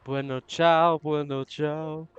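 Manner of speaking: loud Italian farewell, then slowed; hurried goodbye. Acted. Amanda rushing off, calling farewell to friends. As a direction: shout goodbye while leaving.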